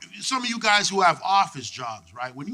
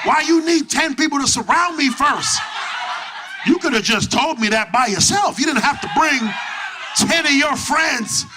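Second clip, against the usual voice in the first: incredulous retort; 8 semitones higher, 4 dB louder than usual. Retold or imitated. Retorting to the ten dudes.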